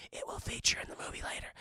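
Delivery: hushed knowing whisper; whispered aside. Pretend. Streamer answers his own question for chat, in a whisper. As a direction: whisper it like a secret spoiler.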